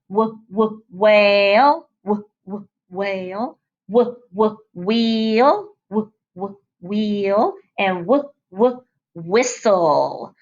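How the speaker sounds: "W, w, whale" with the "w" sound drawn out slowly; stretched W sound. Shown as a demonstration. Teacher models W words for kids to repeat, drawn-out W sounds. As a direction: sound out each W clearly for kids to copy. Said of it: "Say it after me".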